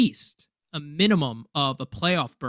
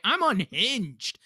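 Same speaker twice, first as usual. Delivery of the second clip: worked up; 7 semitones higher, slower than usual. Real, not acted. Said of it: "I was so mad".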